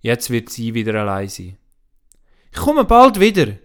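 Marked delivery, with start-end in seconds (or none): 2.5-3.6 put-on a much higher, louder child's promise; girl calling out to comfort granny; Anna comforting. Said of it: "will die Anna sie trösten".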